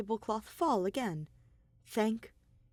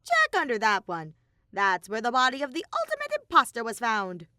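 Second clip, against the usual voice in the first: high, loud cartoon bear voice; louder, higher bratty bear mascot; Monokuma voice; 4 semitones higher, 6 dB louder than usual.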